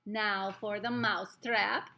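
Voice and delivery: loud witch yell, very drawn out; Grand High Witch. A narrator's reading. Witch announcing a trap for the mouse, yelling. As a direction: call it out slowly with relish. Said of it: "I heard the Grand High Witch yelling".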